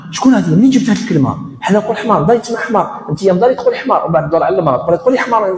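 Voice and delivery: a hammed-up angry mother telling her son off for saying حمار; mock angry parent. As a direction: play the shocked parent berating the boy. Parent scolding child for rude word.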